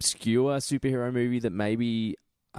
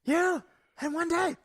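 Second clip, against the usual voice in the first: shouted. Retold or imitated. Joining the Zod impression.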